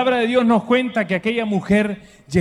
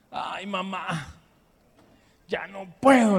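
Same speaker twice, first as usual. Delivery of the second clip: whiny plea to mom; 5 dB quieter, slower than usual. Retold or imitated. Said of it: "le decía yo".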